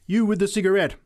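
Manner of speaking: high, loud call-out; single out a sinner across the crowd; accusing shout; preacher's cry. A narrator's reading. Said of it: "cried the preacher".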